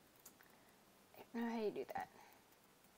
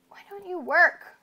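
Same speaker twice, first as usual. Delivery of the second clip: pleading with a machine; 7 semitones higher, 15 dB louder than usual. Real, not acted. Game piece won't place.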